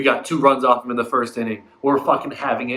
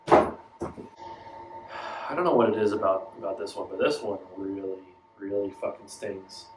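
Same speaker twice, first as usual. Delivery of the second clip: stung and deflated; 7 semitones lower, 6 dB quieter than usual. Real, not acted. Fan stung by elimination.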